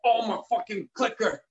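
hammed-up dying anguish. Reprising the spaghetti heart attack for a friend, hammed-up wailing. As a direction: ham up a death scene, wailing over the spaghetti. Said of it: "I'm so glad you took it as long as you took it".

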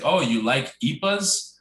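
"ipas" said as one word, not as letters; slow question with "ipas" run together as a word. Retold or imitated. Stranger maybe trying to be funny, "ipas" as one word. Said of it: "Yeah, be all funny".

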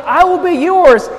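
slow, high "I will be yours"; emphatic divine promise. Acted. Preacher contrasting God's pledge with mere owning, emphatic. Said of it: "what God is emphasizing".

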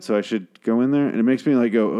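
normal talking voice

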